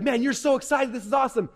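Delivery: imagined friend cheering you on. Retold or imitated. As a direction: hype up your buddy. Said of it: "your friends are gonna see you, and like".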